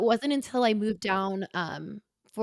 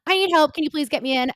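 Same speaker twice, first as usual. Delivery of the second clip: retold plea, higher, louder and fast; urgent SOS plea; 5 semitones higher, 7 dB louder, faster than usual. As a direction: rush it out like a call for help. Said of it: "messaged her like an S O S".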